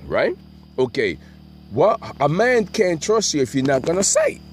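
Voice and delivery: ordinary delivery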